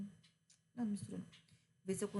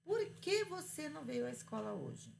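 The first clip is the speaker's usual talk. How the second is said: teacher asking the student why, pitched higher; asking a question; 6 semitones higher than usual. Shown as a demonstration. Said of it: "Aí eu te pergunto".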